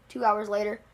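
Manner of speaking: ordinary delivery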